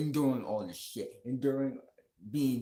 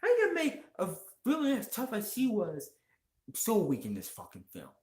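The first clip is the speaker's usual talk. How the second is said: retold reaction, voice pitched way up in outrage; exasperated disbelief; 11 semitones higher than usual. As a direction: squeak it out in total disbelief. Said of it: "He's like".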